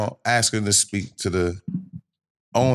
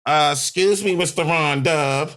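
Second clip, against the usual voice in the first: high, drawn-out imitation of a club-goer; floating dude; 5 semitones higher, slower than usual. Stranger approaching the cousin for his promised bottles, affected. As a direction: mimic him politely calling out, higher and slower. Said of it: "the floating dude comes out".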